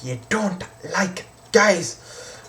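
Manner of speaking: slow, high, loud imitated outburst; angry mum. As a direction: shout each word slowly, as an angry mother. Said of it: "that's when it sparked the anger again".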